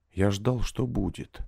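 ordinary delivery

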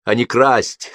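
steeling yourself to speak; plucking up courage. A narrator's reading. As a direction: force it out after hesitant pauses. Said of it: "собравшись с духом, вымолвил он".

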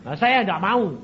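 ordinary talk

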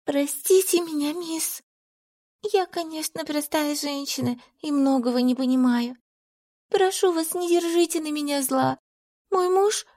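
high, soft, tearful pleading; through tears. A narrator's reading. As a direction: beg forgiveness while crying. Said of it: "проговорила сквозь слёзы".

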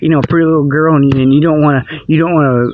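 ordinary delivery

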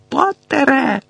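high-pitched, commanding call; sharp call. A narrator's reading. Snape calls Potter across the vestibule, sharp call. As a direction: call out sternly across a hall.

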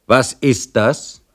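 a hint of a sneering jailer; jailer's challenge. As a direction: drag it out in a mock-stern voice. Jailer finds plate thrown away.

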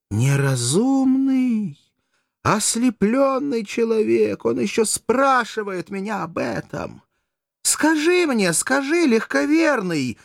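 high, drawn-out theatrical reproach; vehement, exasperated outcry; impassioned exclamation. A narrator's reading. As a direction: lament his blindness to the heavens. Courtesan exclaims, scolding the gullible man.

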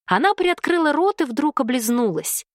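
ordinary delivery

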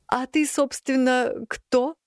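high, questioning cat voice; a fat cat, puzzled; curious cat voice. A narrator's reading. (любопытный кот)

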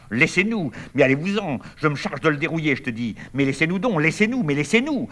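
high, loud angry shouting; furious yelling. Acted. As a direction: yell at them to let go of you. Brothers' brawl, sisters pull them apart. (cris furieux, voix haute et forte)

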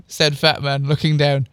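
ordinary delivery

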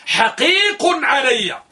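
حقيق shouted high and very slow; stress on حقيق. Shown as a demonstration. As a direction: call out حقيق علي to make the point. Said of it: "فعلى هنا بمعنى إيش".

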